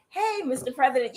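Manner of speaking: tentatively approach the boss; timid staffer. Pretend. Staffer asking to check the president's wording, mock timid. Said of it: "somebody like".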